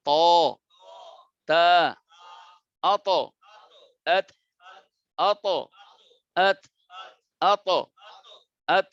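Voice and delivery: isolated ত, তা, আত drilled one by one; drilled letter sounds. Shown as a demonstration. Teacher drilling letter sounds with pauses. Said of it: "মোটা করব".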